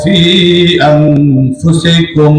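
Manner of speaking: slow drawn-out chanted Arabic; Quran recitation. Acted. Preacher reciting a verse to the crowd, slow chant. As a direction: stretch each word in melodic recitation.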